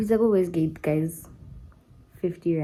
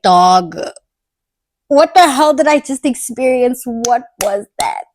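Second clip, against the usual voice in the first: retold freak-out, louder and higher, thrown out fast; freaked-out retelling; 4 semitones higher, 5 dB louder than usual. Calling his friend right after, replaying the shock. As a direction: replay the panic of the moment for your friend. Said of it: "And I was like".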